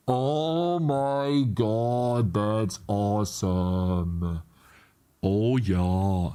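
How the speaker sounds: very slow, stretched-out words; savoring awe. Real, not acted. Coder admiring the working outline, very slow awed drawl. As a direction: stretch every word in satisfaction.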